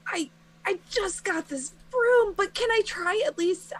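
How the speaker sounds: put-on high, pleading voice of a girl witch; pleading student. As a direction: plead for a go on the new broom. Raven pleads to ride new broom.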